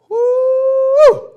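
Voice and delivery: high, loud whoop of relief; big high whoop, then breathe out; relieved whoop. Acted. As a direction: let it fly like you just escaped. Narrator relieved after narrow escape.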